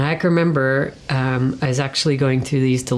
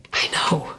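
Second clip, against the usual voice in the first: soft, unvoiced whisper; 4 dB quieter than usual. The Lord whispering back in reassurance. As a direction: hush it, like a gentle answer.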